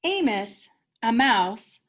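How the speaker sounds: ordinary delivery, nothing marked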